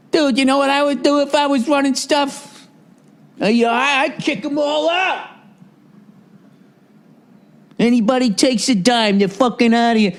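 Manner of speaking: high, slurry barroom braggart; loud drunk in a bar. Retold or imitated. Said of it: "He sounded like a fucking, some loud drunk in a bar".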